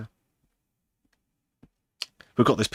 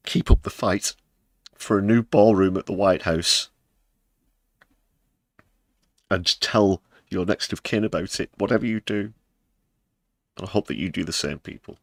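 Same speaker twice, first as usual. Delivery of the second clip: a lightly voiced solemn family heirloom, louder; mock family decree; 3 semitones higher, 5 dB louder than usual. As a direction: read it like a hammed-up sacred family motto. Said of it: "it simply says".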